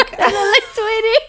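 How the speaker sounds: laughing tease. Real, not acted. Friend teasing her about looking like Tweety, laughing.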